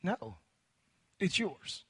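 usual speaking voice

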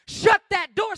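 loud, high order; blunt pulpit command; commanding pastor. Acted. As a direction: shout the order across the room. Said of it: "when the pastor says".

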